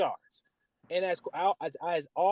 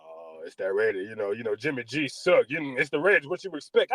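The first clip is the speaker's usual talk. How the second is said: mocking relative; 4 semitones lower than usual. Cousin teasing the Raiders fan, smug and low. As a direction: needle him like a smug cousin.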